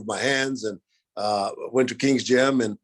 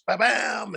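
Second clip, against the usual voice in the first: high-pitched "ba-bam"; punchy sound effect, pitched way up; imitated kick impacts; 9 semitones higher than usual. Fighter retelling slamming the bag, high imitated thud.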